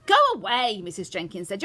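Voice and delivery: indignant and forceful; angry dismissal. A narrator's reading. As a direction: snap at an unwelcome stranger. Mother dismissing grandmother in quarrel.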